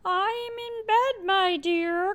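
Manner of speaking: high, slow put-on old lady voice; wolf pretending to be granny; fake grandma voice. A narrator's reading. Wolf in bed faking grandma's voice to the girl, high and slow. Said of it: "called a voice through the door".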